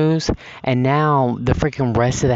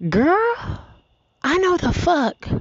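much higher, squeaked-out mock outrage; high squeaky outburst; slower than usual.

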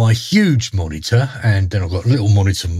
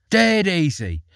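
light, higher, offhand fairy voice; breezy fairy; 8 semitones higher, slower than usual. Acted. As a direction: toss it off like a cheeky fairy.

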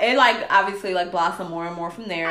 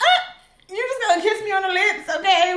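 very high, squeaky mock disbelief; hammed-up high teasing voice; mock shocked voice; 12 semitones higher than usual. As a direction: squeak it like a scandalized coworker. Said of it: "I was like".

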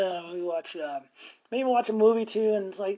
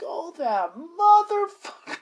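outraged realization; 10 semitones higher, 6 dB louder, slower than usual. Retold or imitated. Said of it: "I'm like".

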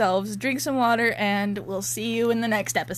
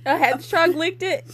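giggling through the words; high and giddy, laughing; 8 semitones higher than usual. Real, not acted. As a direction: laugh while you say it. Laughing at the scene.

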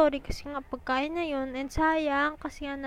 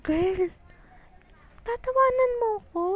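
put-on higher, offended girl are you laughing at me; mock offended, pitched up; indignant retort; 8 semitones higher, 4 dB louder than usual. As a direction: call out the friend in disbelief.

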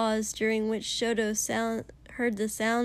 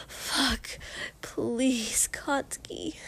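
breathy plea; 3 semitones higher, much slower than usual. Acted. Denki pleading.

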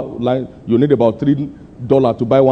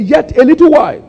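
high ringing burst on the opening words; rising proclamation; 12 semitones higher, 6 dB louder than usual. Retold or imitated. Preacher quoting his own prophecy to the church, ringing high. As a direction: lift the voice high and declare. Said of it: "I said".

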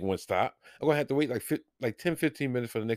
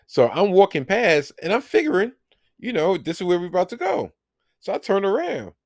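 excited; 10 semitones higher, 6 dB louder than usual. Real, not acted. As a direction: tell it all worked up. Excited retelling of seat mix-up.

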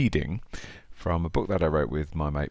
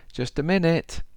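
put-on higher old lady voice; lightly voiced frail old lady; frail elderly voice; 9 semitones higher than usual. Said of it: "answered a frail elderly voice".

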